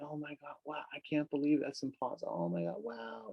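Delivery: high-pitched wow of happy disbelief; amazed disbelief. Acted. Imagined person hearing it worked out, amazed and high-pitched. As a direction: act out being floored by good news. Acted reaction to sudden good news.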